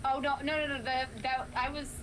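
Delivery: normal talking voice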